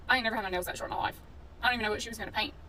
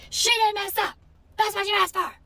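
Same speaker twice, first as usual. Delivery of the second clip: much higher and louder, a man shouting back; hollering salon man; 10 semitones higher, 8 dB louder than usual. Retold or imitated. Owner defending his tech, hollering high and loud. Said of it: "He hollered at me loud".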